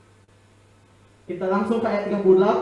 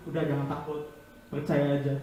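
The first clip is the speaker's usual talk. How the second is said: soft reassurance; 5 semitones lower, 7 dB quieter than usual. Acted. Quoting calming words to the dying.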